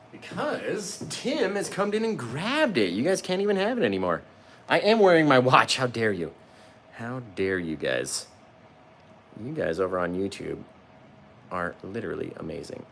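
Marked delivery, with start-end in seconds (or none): 0.1-8.3 mock outrage. Mock outrage at a sold painting.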